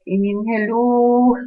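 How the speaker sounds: high, loud, slow drawn-out hello; exasperated hello. Real, not acted. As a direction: stretch it out in disbelief. Hindi makapaniwala sa sinabi niya. (mataas, malakas at hinahabang hello)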